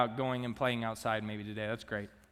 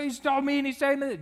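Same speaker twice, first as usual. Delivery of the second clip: put-on much higher, whiny child complaining; upset first grader; 12 semitones higher, 4 dB louder than usual. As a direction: plead like a hurt little kid. Upset boy complains on bus.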